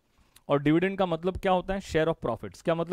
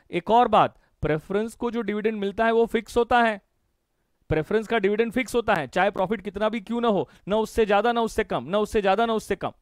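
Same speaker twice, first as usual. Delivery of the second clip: louder, higher, hammering the rule home; raised teacher voice beating out each phrase; emphatic teaching; 5 semitones higher, 5 dB louder than usual. Shown as a demonstration. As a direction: drum the rule in like a chant. Teacher stresses the fixed-dividend rule.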